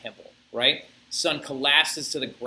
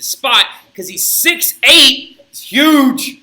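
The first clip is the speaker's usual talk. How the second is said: louder and higher, marveling at his size; amazed exclamation; 10 semitones higher, 11 dB louder, much slower than usual. Real, not acted. Teacher telling the class how tall Peter was, amazed and loud. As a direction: really sell how huge Peter was.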